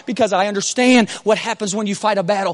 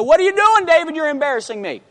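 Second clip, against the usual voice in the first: lightly mimicked scolding wife, voice raised in pitch at first; 6 semitones higher, 3 dB louder than usual. Wife to David from the window, mock scolding. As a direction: imitate an embarrassed wife calling out.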